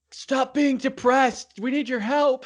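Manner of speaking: high, slow put-on yell; mock yelling. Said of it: "they're even yelling at her".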